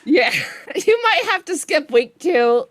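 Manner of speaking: laughing through the words; can't stop laughing at the bug. Real, not acted. Developer laughing at the broken event, laughing.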